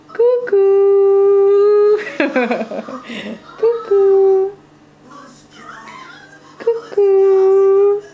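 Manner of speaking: loud, high peekaboo calls. Real, not acted. Peekaboo with baby.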